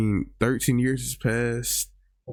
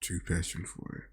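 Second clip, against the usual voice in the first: whispered echo; 2 semitones lower, 8 dB quieter than usual. Real, not acted. Echoing the answer under breath.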